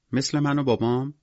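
put-on high-pitched child's question; small, high childish voice; wondering little girl. A narrator's reading. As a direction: ask innocently, like a small child. Little girl compares with herself.

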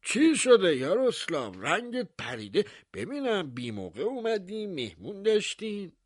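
high, polite voice of the town mayor; mayor's voice. Acted. Mayor greeting the baker at his door, high and courteous. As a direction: greet the host with showy courtesy, pitched high.

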